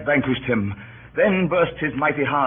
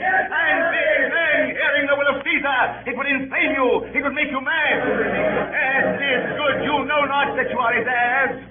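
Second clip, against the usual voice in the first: rousing shout; 11 semitones higher than usual. Acted. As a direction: stir the mob with a ringing cry. Rouses the crowd about the will.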